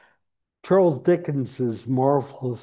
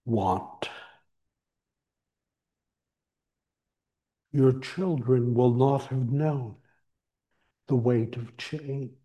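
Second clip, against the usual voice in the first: solemn scripture reading; 3 semitones higher than usual. Acted. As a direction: intone it like a sacred text.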